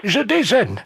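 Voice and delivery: put-on high-pitched old lady; squeaky elderly woman on the phone. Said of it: "passer le téléphone à la dame".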